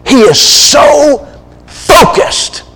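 high, very slow stretch on "so focused"; emphatic stress. Real, not acted. Preacher on Balaam's greed, "so focused" high and drawn out. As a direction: draw out "so" and land hard on "focused". Preacher hammering the point home.

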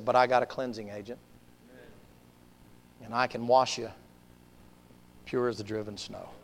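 low, hushed promise; gentle reassurance. Acted. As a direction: soften right down to a tender close. Jesus gently promises cleansing.

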